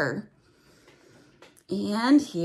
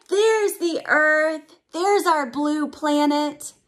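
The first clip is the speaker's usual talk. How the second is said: awed gasp; 6 semitones higher, 4 dB louder than usual. Acted. Gasping at the Earthrise.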